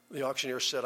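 ordinary talk, nothing marked

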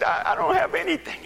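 high, loud mock crying; tearful man. Penniless man tells his pastor, high tearful imitation. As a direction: stammer it in an imitated wail. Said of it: "he was just a-crying".

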